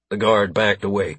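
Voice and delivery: ordinary delivery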